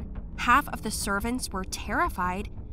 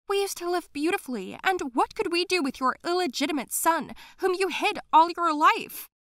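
bitter nanny; 9 semitones higher, 5 dB louder than usual. Acted. Nanny defends her crime.

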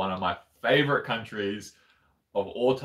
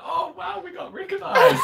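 much higher, giddy and laughing; excited squeal; 5 dB louder than usual. Retold or imitated. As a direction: squeal it like a starstruck fan. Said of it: "I just wanna be like".

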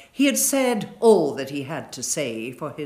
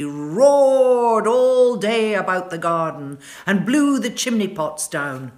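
roaring narration; 4 semitones higher, 8 dB louder than usual. A narrator's reading. Narrating the roaring north wind.

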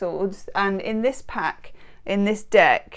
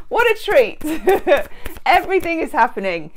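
excited; 6 semitones higher, 3 dB louder than usual. Real, not acted. Washed hair, joking.

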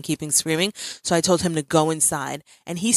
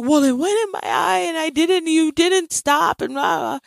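high-pitched mock kid wail; imitated whining child; 12 semitones higher than usual. Upset son protesting to mom, high imitated whine. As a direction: whine it like an upset little boy, trailing into blah. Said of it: "And he was like".

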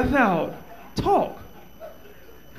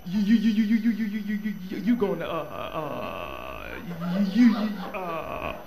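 mock stutter; 2 semitones lower, 6 dB quieter than usual. Imitating a stutterer's blocked speech.

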